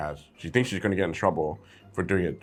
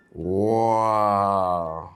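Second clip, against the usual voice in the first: demo wow. Shown as a demonstration. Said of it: "open the spine a little bit and go".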